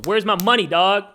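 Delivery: loud, playful shakedown; mock demand. Joking to TikTok, he loudly demands payment. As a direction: demand it with fake swagger.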